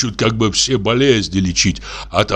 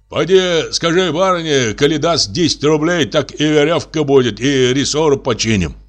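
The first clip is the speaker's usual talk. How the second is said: haggling innkeeper; 7 semitones higher than usual. Acted. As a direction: drive a hard bargain. Innkeeper Tikhon names his price.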